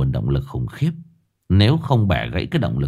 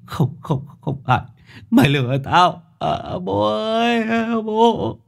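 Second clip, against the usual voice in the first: high, wailing cry for father; frantic denial; 14 semitones higher than usual. A narrator's reading. Captive seeing the photos, wailing in denial. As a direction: break down calling for dad. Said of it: "vang lên tiếng ầm ĩ".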